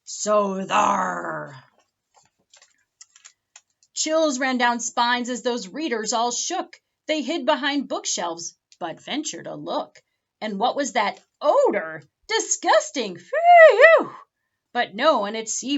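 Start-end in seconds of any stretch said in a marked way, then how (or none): 0.0-1.6 scary pirate growl. Acted. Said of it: "let loose with a scary". 12.3-14.1 high, loud yelp of disgust. Acted. Readers smell the pirates and cry out in disgust.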